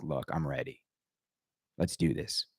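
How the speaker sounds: low, soft quoted aside; quiet imagined pitch. Retold or imitated. Designer at the company's door offering himself, quiet and low. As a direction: drop low and soft, understated.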